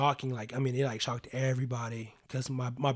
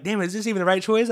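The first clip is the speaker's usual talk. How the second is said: much higher, mock-panicked inner voice; panicked self-doubt; 7 semitones higher, 7 dB louder than usual. A beginner filming basketball doubts himself, high mock panic. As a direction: throw up your hands, high and flustered.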